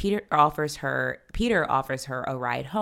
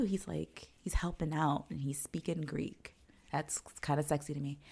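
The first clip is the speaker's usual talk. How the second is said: hushed confession; whisper; 11 dB quieter than usual. Real, not acted. Admitting Peter speaking Greek is sexy, whispered. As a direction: whisper it like a guilty secret.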